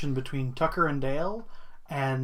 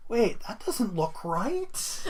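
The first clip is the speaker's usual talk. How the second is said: puzzled, slow and laughing; 7 semitones higher, 4 dB quieter, much slower than usual. Retold or imitated. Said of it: "you're like".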